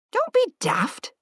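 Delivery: blunt, high, slow retort. A narrator's reading. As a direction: cut him off bluntly. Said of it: "said Edith bluntly".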